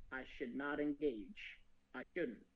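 low, hushed self-talk of a man trying not to give in; quiet mock resolve; hushed inner voice. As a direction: mutter it like you're losing a fight with yourself. Resisting temptation, told in quote.

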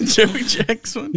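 laughing it out, high and slow; can't believe the call, cracking up; laughing disbelief. Real, not acted.

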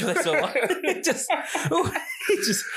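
high, breaking into laughter mid-sentence; real laughing. Real, not acted. Podcaster losing it over his wasp joke, laughing as he talks. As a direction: try to talk through the giggles.

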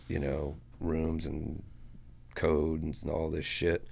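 plain reading voice

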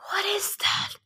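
breathless, shocked whisper; gasping whisper. Acted. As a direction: whisper it, repelled by the stench. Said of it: "Even Nico gasped".

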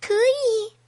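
put-on small, high baby reply; little baby piping up. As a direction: answer in a tiny high voice. Baby answers from the belly.

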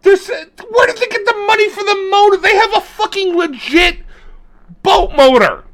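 incredulous. Real, not acted. Outraged rant about the motor.

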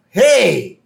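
high, loud protest; indignant shout. Retold or imitated. Officer whose hat was just snatched, indignant. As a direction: call out as if robbed.

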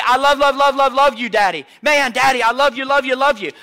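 lightly imitated kids gushing at their dad; a touch of a child's loud, breathless enthusiasm; excited children. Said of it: "My children will bust through the door".

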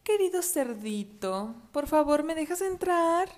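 slow, high, honeyed wolf voice; slow sugary wolf pretending to be kind; sweetly kind. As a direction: fake friendliness to trick the piglet. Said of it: "dijo con amabilidad".

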